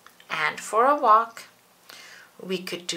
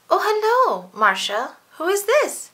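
much higher, louder furry monster voice; Grover voice; 10 semitones higher, 7 dB louder than usual. Acted. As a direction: squeak it out bright like Grover.

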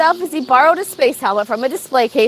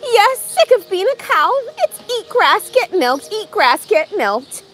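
lightly voiced cow, higher and complaining; whiny cow Martha; 6 semitones higher than usual. Cow Martha complains to Rob, lightly voiced whine. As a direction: moan it like a fed-up cow.

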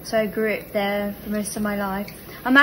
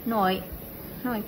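British NIGHT, said like "noit"; put-on British vowel on NIGHT; British accent; 6 dB quieter than usual. Shown as a demonstration. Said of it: "in English you say".

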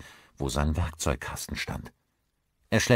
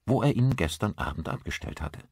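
ordinary delivery

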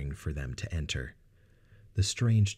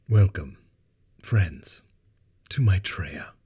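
regal gravitas; 3 semitones higher, 6 dB louder, slower than usual. Acted. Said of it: "timbre and gravitas".

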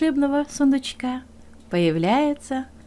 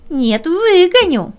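higher, louder rooster voice; rooster asking; 8 semitones higher, 8 dB louder, slower than usual. Acted. Rooster asks the hare why he cries, higher and louder. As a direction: ask brightly like a rooster.

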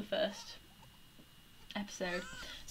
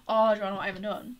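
ordinary talk

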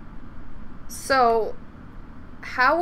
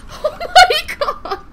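laughing; 5 semitones higher, 5 dB louder, slower than usual. Real, not acted. Laughing relief after near death.

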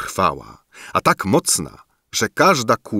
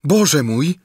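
dismayed sigh; 7 semitones higher than usual. A narrator's reading. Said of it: "westchnęła Różyczka".

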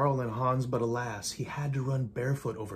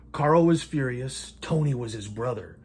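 plain reading voice

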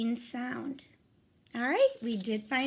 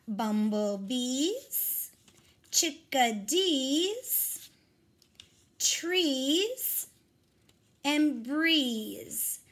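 slow, stretched word reading; much slower than usual. Shown as a demonstration. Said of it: "cut off the beginning chunks".

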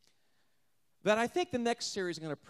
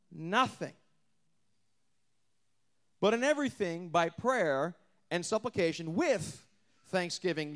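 slow, deliberate true reading; correct reading; slower than usual. Acted. Preacher correcting the misquote, slow and deliberate. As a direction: lay out each word solemnly. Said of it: "No, that's not what it says".

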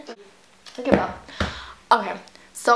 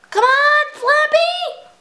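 very high, loud squeal; frustrated yell; 6 dB louder, slower than usual. Real, not acted. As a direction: squeal at the bird to move. Urging the game bird on.

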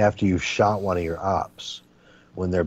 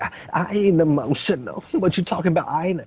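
high crooning mock denial; 10 semitones higher than usual. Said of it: "he sounds like [name], crooning".

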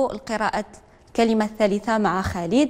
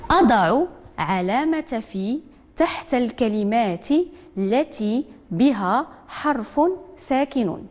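slow, deliberate reading of the instruction; teacher dictating word by word; slow dictation; much slower than usual. Shown as a demonstration. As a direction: read it out slowly for young pupils. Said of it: "teacher dictates the instruction".

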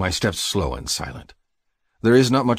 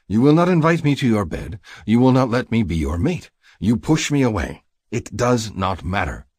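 louder, higher impassioned accusation; heated, raised-voice protest; furious; 5 semitones higher, 5 dB louder than usual. A narrator's reading. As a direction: list your grievances with rising heat. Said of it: "suddenly furious".

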